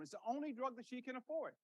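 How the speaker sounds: ordinary talk, nothing marked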